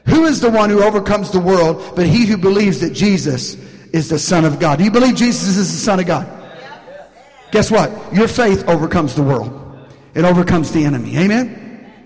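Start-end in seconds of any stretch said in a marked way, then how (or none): none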